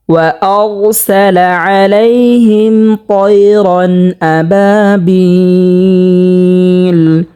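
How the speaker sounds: slow, drawn-out melodic chant; Quran recitation. Shown as a demonstration. Teacher reciting the verse for viewers, slow melodic chant. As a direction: stretch each vowel in a sung recitation.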